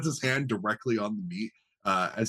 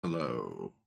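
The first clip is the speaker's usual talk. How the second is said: low flat cultist; 10 semitones lower, 7 dB quieter than usual. Acted. GM voices cultist reply.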